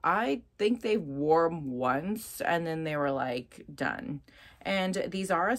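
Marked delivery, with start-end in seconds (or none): none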